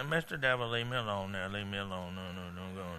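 soft, low, fading timid plea; mealy-mouthed. Shown as a demonstration. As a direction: mumble it meekly, trailing away to nearly nothing. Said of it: "you can't just mealy mouth around".

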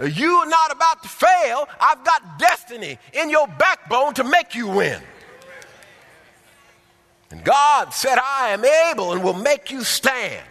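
loud, high preaching voice. Acted. Preacher driving the sermon to the congregation, loud and high. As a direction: preach it with full force.

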